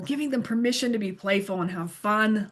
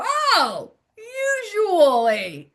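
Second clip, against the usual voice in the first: high, drawn-out aha on "usually"; sudden realization; 8 semitones higher, much slower than usual. Retold or imitated. Teacher acting learners who suddenly get it, delighted realization. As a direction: act out a learner finally getting it. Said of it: "they go".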